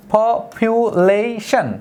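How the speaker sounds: Population very slow, po-pu-la-tion split apart; syllable by syllable. Shown as a demonstration. Teacher repeating population slowly in syllables. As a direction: draw out each beat of population. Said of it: "তারপর হচ্ছে population".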